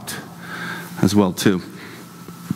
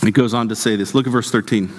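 ordinary talk, nothing marked

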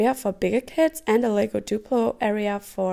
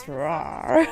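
dinosaur voice; 5 semitones higher than usual. Retold or imitated. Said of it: "Nolan is just running around and says".

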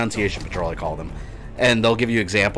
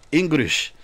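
higher, drawn-out "Engrish" in mock Japanese English; mock Japanese accent; 6 semitones higher than usual. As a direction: put on a joking Japanese accent. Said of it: "It was English".

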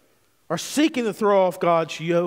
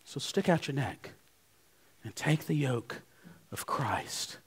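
dropping to a near whisper; hushed plea; 7 semitones lower, 11 dB quieter than usual. Real, not acted. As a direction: lean in and say it softly, almost breathless. Preacher drops to hushed plea.

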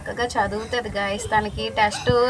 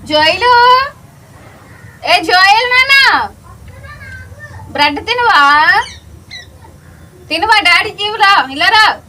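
much higher and louder calling out; 9 semitones higher, 10 dB louder than usual. Real, not acted. Mother calling her son Joel over to eat bread.

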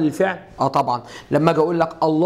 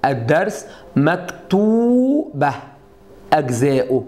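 الدرس مكتوبه drawn out slowly; slow model phrase; 3 semitones lower, much slower than usual. Shown as a demonstration. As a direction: stretch مكتوبه for the class. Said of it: "اقول لك هنا ايه؟".

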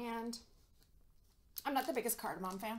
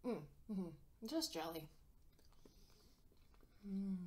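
chewing while talking; words muffled by food; mouth full of chocolate; 4 semitones lower, 9 dB quieter, slower than usual. Real, not acted. Seen 0: Bites chocolate piece near 1.1–1.8s, then mouth moves as if chewing.

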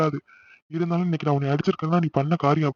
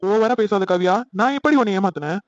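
angry; 4 semitones higher, 4 dB louder than usual. Acted. Lovers quarrel on phone.

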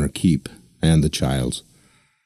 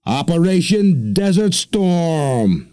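slow, high, coaxing reveal; seductive; 11 semitones higher, much slower than usual. Acted. Deacon tempting volunteers, slow and seductive. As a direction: dangle the prize, drawing it out. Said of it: "the deacon said seductively".

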